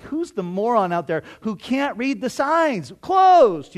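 irritated grumble that bursts into a loud, high shout on Closed; annoyed yell. Retold or imitated. Night-shift stocker yelling at someone knocking after closing, loud annoyed shout. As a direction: yell through the glass at the fool knocking. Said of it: "I'm like".

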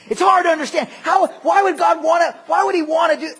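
high, shouted objection; bewildered protest. Acted. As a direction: voice a baffled listener getting worked up. Acting out bewildered listeners' objection.

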